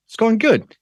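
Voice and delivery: lightly voiced kid, pitched well up; young boy's reply.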